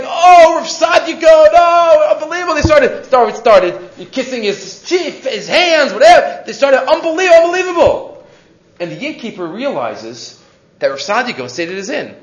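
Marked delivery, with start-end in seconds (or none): 0.0-2.5 loud, high mock exclamations of amazement; starstruck excitement. Guests recognizing the rabbi at the inn, starstruck. 6.8-8.3 high-pitched, imitated gushing; starstruck amazement. Guests marvelling at the famous rabbi, amazed.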